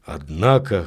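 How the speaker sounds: low, slightly hoarse interjection. A narrator's reading. Tarrant objecting to the priest, slightly hoarse. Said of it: "хрипло проговорил Тарент".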